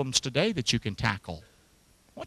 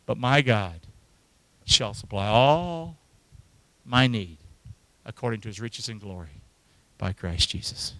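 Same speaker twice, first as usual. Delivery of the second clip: relaxing; 5 semitones lower, 3 dB quieter than usual. Acted. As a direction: let the verse settle them gently. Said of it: "Isn't that just sort of more relaxing?".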